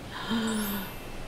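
soft gasp of delight. Real, not acted. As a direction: gasp in awe at something pretty. Seeing the pretty corset.

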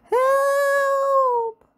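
very high, loud bellow for help; huge shout. A narrator's reading. Mister Noisy shouts for help, very loud and high. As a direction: shout to be heard through the pyramid. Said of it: "shouted at the top of his very, very loud voice".

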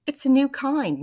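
a hint of a high, sly Missus Twit; lightly voiced innocent lie; sly wife. Missus Twit fibs to her husband over lunch, lightly sly voice. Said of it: "Missus Twit said".

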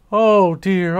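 first anxious oh dear, the strongest; Aunt Flounder fretting over Flash, first cry; distressed cry. A narrator's reading. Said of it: "cried Aunt Flounder".

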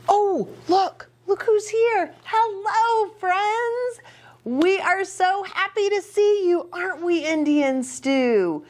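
high, bright puppet voice greeting friends; squeaky puppet greeting. Acted. Puppet says hello to the children in a high squeaky voice. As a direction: play the puppet welcoming the kids.

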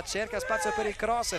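ordinary talk, nothing marked

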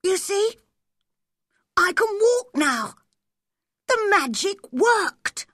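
put-on very high, triumphant boy; high-pitched boyish triumph; Colin. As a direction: announce the success in a high child's voice. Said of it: "he said".

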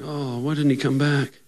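softly whining believers; mock disappointment. Disappointed Christians ask after Jesus, in mock dismay. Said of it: "Weren't the people like".

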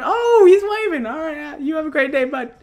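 delighted. Real, not acted. NPC waves at him.

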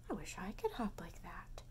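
wistful hushed whisper; soft whispered longing. Acted. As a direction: wish it under your breath.

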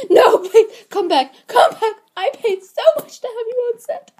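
high-pitched, tearful begging; desperate pleading. Acted. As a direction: beg them frantically to come back. Director begs actor to return.

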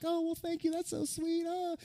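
high, soft sweet mom voice; sweet mother. Retold or imitated. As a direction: coo it like a flattered mother. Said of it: "she was like".

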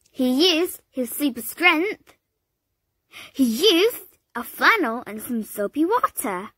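ordinary delivery, nothing marked

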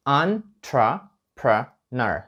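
Entrepreneur very slow, "on-tre-pre-ner" syllable by syllable. Shown as a demonstration. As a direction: stretch Entrepreneur into four syllables. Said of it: "вимовляється як". (Entrepreneur дуже повільно, чотири склади: он-тре-пре-нер)

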